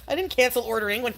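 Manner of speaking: high cackling laugh; witchy cackle. Real, not acted. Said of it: "I sound like a witch".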